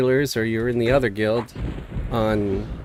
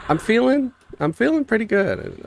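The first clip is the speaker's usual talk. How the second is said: pitched-up cheerful answer; bright upbeat; 11 semitones higher than usual. Real, not acted. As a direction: sound chipper and high.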